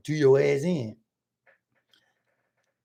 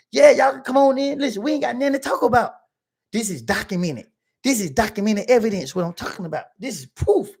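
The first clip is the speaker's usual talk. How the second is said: raised, insistent higher pitch; loud argument; 6 semitones higher than usual. Retold or imitated. Client waving in the prosecutor to show proof, loud and insistent. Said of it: "I'm loud".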